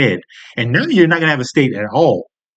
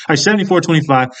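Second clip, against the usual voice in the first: usual speaking voice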